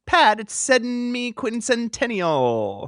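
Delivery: semi-quincentennial said slowly, each syllable clear; slow corrective semi-quincentennial; careful pronunciation. Shown as a demonstration. Host insisting to cohost, slowly over-pronouncing the word. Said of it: "the word he was looking for".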